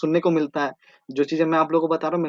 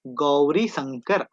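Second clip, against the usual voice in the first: slow drill; 3 semitones lower, much slower than usual. Shown as a demonstration. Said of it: "teacher drilling the mountain name".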